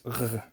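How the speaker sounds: guttural "r" made at the back of the throat; French-style R. Shown as a demonstration. Teacher showing how most Hebrew speakers say R, guttural. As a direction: say "r" like a French or German R. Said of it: "akin to what you have in French R".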